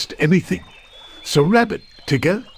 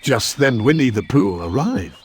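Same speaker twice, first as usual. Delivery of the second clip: plain reading voice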